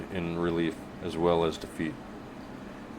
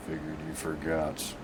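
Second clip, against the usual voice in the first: gruff grunt; 9 dB quieter than usual. Acted. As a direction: grunt it out like a burly man. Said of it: "burly man grunts".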